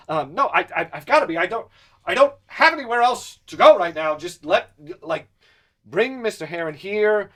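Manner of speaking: rising panic, begging, nervous laugh; desperate pleading. Acted. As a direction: beg to be let in. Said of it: "And he says".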